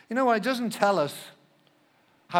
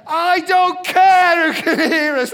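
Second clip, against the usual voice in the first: much higher and louder, slow, with a laugh breaking through; defiant shout; 9 semitones higher, 11 dB louder, slower than usual. Retold or imitated. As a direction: yell it back, not caring who hears. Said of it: "And I said".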